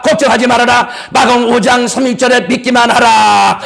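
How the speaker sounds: rousing sermon delivery, calling out to the church; fervent preaching. Acted. Pastor rallies the congregation, preaching at full force.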